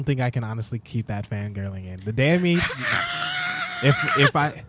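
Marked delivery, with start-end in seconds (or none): 2.6-3.8 high shrieking fangirl laugh; a giddy high-pitched shriek of laughter; squealing laugh. Real, not acted. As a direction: squeal with delight. Fangirl excited at Nickelback memory.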